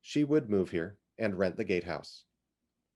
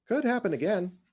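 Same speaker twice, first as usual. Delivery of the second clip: higher, bright voice with a warning in it; 11 semitones higher than usual. Acted. Iz warns Cynthia the flood could return, bright and warning. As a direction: ring out the warning like a gong. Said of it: "her voice as bright as a gong that trembles with warning".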